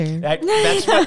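high, dry sarcastic jab. Acted. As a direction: say it with mock outrage. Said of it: "Your sarcasm".

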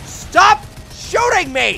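high, slow frantic yell; shouting. Real, not acted. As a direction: yell at the shooters. Gamer shouting at enemies.

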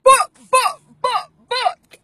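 very high, loud hype chant; shouted, almost crying, excited chant. Real, not acted. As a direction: hype the crowd up, yelling. Host excitedly urging to book.

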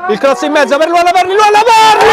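high, loud goal call; breathless italian goal commentary. Real, not acted. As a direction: shout the goal, rising with joy. Goal scored, commentator erupts.

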